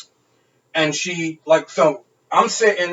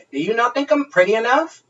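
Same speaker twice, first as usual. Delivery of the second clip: mock drunk girl; 7 semitones higher than usual.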